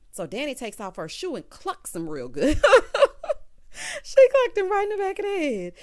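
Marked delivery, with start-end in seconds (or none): 2.6-4.0 loud, high belly laugh; big laugh. Real, not acted. Recapper laughing at the shoe hit, loud cackle. 4.0-5.7 high, loud, laughing delight; laughing exclamation. Real, not acted. Retelling Danny's shoe hit, loud and laughing.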